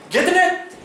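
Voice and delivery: high, loud excited shout; excited yelling. Retold or imitated. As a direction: yell it like your big catch is on. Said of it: "he's really excited".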